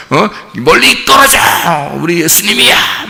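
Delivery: sudden high shout pushing people away; mock bossy shout. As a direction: bark it like a self-important bodyguard. Peter voiced shooing crowd off. (거들먹거리는 외침)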